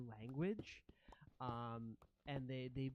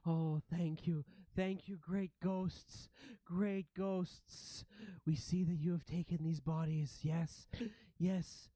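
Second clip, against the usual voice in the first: louder, higher fawning rat voice; grateful ratling; 4 semitones higher, 4 dB louder than usual. Acted. Ratling thanking great ghosts for mercy, fawning high voice. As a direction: gush thanks in a creature voice.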